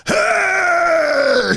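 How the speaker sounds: high, loud street yell; sudden high shout, mock maniac; scream. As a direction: bellow at passers-by. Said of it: "he had that voice".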